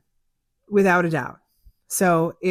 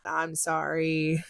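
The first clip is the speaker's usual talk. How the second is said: meek apology; 7 dB quieter, slower than usual. Retold or imitated. Apologizing to angry client.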